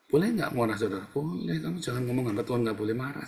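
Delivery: usual speaking voice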